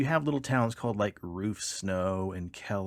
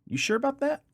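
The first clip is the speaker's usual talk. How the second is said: much higher, skeptical cop voice; suspicious trooper; 4 dB louder than usual. Retold or imitated. Trooper doubts the driver's story, high and skeptical. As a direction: raise it high with doubt, like a cop catching a lie.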